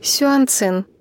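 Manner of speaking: ordinary delivery, nothing marked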